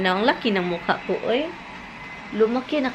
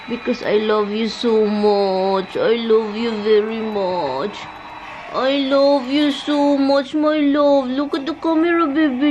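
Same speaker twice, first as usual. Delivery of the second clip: sweet nothings; 2 semitones higher than usual. Real, not acted. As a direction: coo it to the dog. Cooing to her dog.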